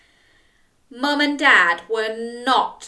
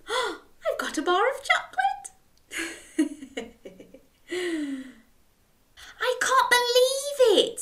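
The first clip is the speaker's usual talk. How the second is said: amazed Jack; 12 semitones higher than usual. Acted. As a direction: gasp it out, thrilled and giggly. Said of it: "said Jack".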